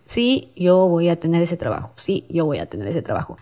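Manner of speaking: half-hearted, fading affirmation; without conviction. Shown as a demonstration. Host showing how not to say an affirmation, without conviction. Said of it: "no lo vas a decir".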